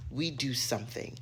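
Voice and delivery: ordinary delivery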